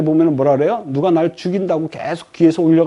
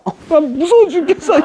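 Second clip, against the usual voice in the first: high-pitched cowering husband; hammed-up fright, clinging to the wife; mock terrified; 9 semitones higher than usual. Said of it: "남자가 오들오들 떨다가요". (겁에 질린 척 높은 목소리로)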